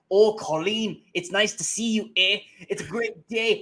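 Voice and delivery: hammed-up Canadian accent, pitched high; Austin Butler. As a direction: overdo a mock Canadian accent, loud and lilting. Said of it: "really doing that Canadian accent".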